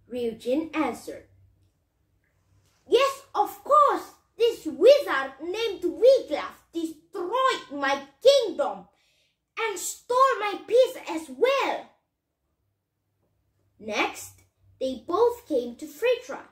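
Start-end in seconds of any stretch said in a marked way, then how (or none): 2.9-11.9 dragon king agreeing. Acted. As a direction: answer grandly as the sea ruler. Dragon ruler answers the hero.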